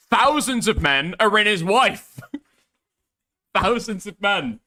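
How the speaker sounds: high, laughing through the joke; laughing at his own joke; laughing punchline. Real, not acted. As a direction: crack up while delivering the punchline. Mocking joke about Jeremy's wife.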